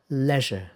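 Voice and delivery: leisure with a short "e", rhyming with pleasure; British pronunciation. Shown as a demonstration. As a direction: say leisure as "LEZH-uh". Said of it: "Sounds like completely different words".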